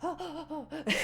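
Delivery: gasping mock astonishment; mock shock. Podcaster imitating everyone's reaction to the villains' plan, mock shocked. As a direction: play up a gasp of shock.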